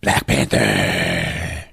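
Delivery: very low, drawn-out growl; dramatic hero reveal. Acted. Flashlight finds the hero in the trees, deep slow growl. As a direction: stretch it out deep and ominous.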